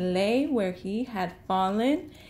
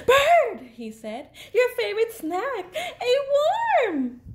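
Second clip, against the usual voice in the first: much higher, laughing, fast-paced glee; excited Fox; 13 semitones higher, 4 dB louder than usual. Acted. Fox teasing Bird with a worm, giggly high excitement. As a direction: squeal it out, giggling with mischief.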